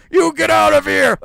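loud, high furious order; angry shout. Acted. Principal yelling at the demon to get out. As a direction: throw him out.

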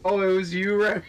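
loud, high burst of recognition; surprised realization. Real, not acted. Realizing the unknown caller was a friend, loud surprise. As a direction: exclaim it as the penny drops, breaking into laughter.

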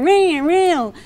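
playful imitation of a dead car; imitated old car ring. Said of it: "old-time car sound that won't turn on".

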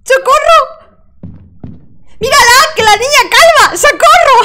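shrieking high and loud in panic; alarm shout. Real, not acted. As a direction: scream it while running for your life. Ghost chasing her.

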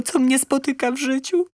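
normal talking voice

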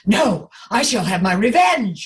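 loud villainous vow of revenge; chest character voice. Shown as a demonstration. Teacher demonstrating a vengeful character, loud chest voice. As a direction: declaim it forcefully from the chest. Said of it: "move a little bit up in the chest".

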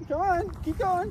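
high slow cheer. Real, not acted. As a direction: cheer the dog on like a race. Cheering the dog on.

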